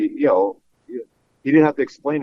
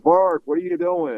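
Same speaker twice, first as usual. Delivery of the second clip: higher, drawn-out imitation of a broadcaster; Harry Kalas; 7 semitones higher than usual. Broadcaster teasing a tongue-tied guest in the booth, imitated voice. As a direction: broadcaster greets his nervous guest, slow raised-pitch imitation. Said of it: "with Harry Kalas".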